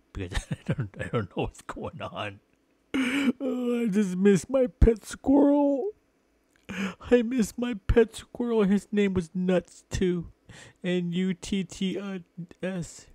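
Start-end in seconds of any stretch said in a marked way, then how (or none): 3.4-13.1 high, loud mock sobbing whine; hammed-up grief. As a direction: wail over the dead squirrel like a cartoon. Mock grief over lost pet squirrel.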